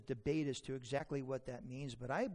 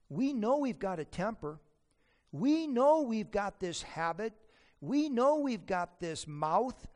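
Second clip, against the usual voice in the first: each line louder and more insistent; building emphasis; 4 semitones higher, 7 dB louder than usual. Acted. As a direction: hammer the repeated we know. Preacher building repeated lines.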